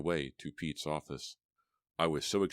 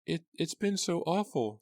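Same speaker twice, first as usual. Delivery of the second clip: high, stammering, on the edge of tears; trembling stammer; 14 semitones higher, 3 dB louder than usual. A narrator's reading. Grieving mother on the phone, high and stammering. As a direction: stammer it out through grief. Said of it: "she stammered".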